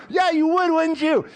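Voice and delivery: high, loud laughing tease; teasing laugh. Real, not acted. Pastor teasing his friend Greg, laughing loudly. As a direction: rib him, laughing, way up high.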